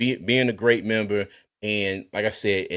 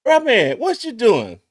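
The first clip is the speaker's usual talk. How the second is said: imitating Doug; 11 semitones higher, 5 dB louder than usual. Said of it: "get that deep voice".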